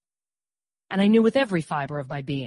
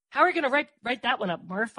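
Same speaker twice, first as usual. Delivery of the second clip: higher-pitched, curious question; inquiring; 6 semitones higher, 4 dB louder than usual. A narrator's reading. Narrator asks Murph about his write-up, inquiring. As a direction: ask it with a lifted voice. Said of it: "I inquired".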